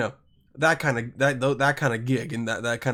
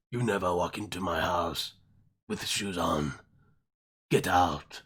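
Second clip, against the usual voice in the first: much lower, soft, menacing growl; low threat to a rude guest, held back; menacing host; 9 semitones lower, 7 dB quieter than usual. Acted. Host warning the shoe-wearing guest, low soft menace.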